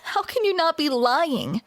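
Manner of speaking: ordinary delivery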